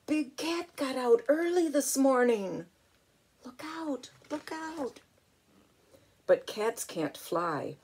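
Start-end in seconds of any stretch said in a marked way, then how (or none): 0.1-5.0 high, hushed alarm call of a bird; bird's warning call. Acted. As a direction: warn the other birds, pitched up.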